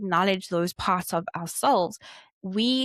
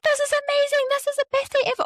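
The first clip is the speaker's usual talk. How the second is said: put-on much higher, louder, giddy girl's squeal of joy; Rapunzel's giddy delight; 4 dB louder, faster than usual. Rapunzel leaving her tower for the first time, squealing with joy. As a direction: gush like a princess free at last. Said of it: "Rapunzel from Tangled".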